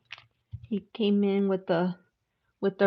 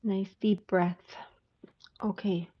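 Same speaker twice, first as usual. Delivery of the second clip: slow whispered breathing cue; whispering before a tarot reading; 6 dB quieter than usual. Real, not acted. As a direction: hush to settle the energy. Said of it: "let me clear energy".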